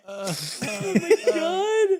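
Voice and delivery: high, soft, stretched-out gasp through laughing; helpless laughter. Real, not acted. Co-host recovering from the fit, helplessly laughing. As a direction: let it out slowly, wrecked by laughter. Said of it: "Wheezing to death".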